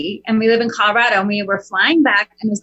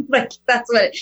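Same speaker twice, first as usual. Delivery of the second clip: ordinary talk, nothing marked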